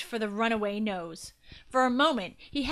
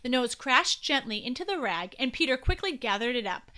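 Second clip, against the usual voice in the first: ordinary delivery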